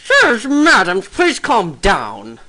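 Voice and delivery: high, very slow, drawn-out character voice; calling for order. Acted. Chairman asking sirs and madams to calm down, drawn out. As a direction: stretch out a plea for calm, starting loud.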